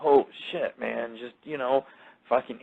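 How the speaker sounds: normal talking voice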